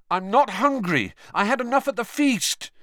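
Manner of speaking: high-pitched, exasperated refusal; protesting. Acted. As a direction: protest over the din. Harry protests amid noisy party.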